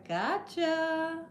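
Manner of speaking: soft, drawn-out teasing lilt; quiet mock taunt, rising at the end; imitating sneaky gotcha. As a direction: tease softly, imitating the lady. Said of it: "She gonna look back and say".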